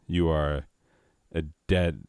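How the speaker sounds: ordinary delivery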